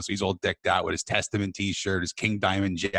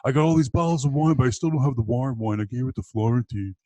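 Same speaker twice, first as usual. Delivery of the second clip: imitating a friend's loud, higher-pitched lament; hammed-up complaint over a lost treasure, voice raised; mock heartbroken; 6 semitones higher, 4 dB louder than usual. Friend still lamenting the wine he gave away, mock heartbroken. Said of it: "I never saw this kid's heartbreak".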